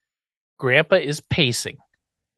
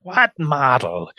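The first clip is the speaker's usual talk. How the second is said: slow, drawn-out bewilderment; hammed-up baffled professor; professor impression; slower than usual. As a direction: stretch out each word, baffled. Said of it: "that was a pretty good impression".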